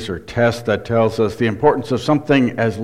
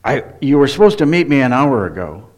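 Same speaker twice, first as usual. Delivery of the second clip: "Where were you" snapped in mild irritation; a touch of annoyed impatience; irritated; 3 semitones higher, 4 dB louder than usual. Shown as a demonstration. Pastor playing a friend kept waiting an hour.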